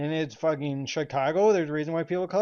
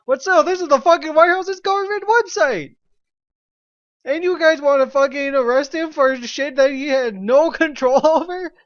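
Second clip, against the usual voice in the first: much higher and louder, shrieking disbelief; outraged high-pitched yell; incredulous shout; 8 semitones higher, 9 dB louder than usual. Real, not acted. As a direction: yell it like you can't believe it. Incredulous outburst about petition.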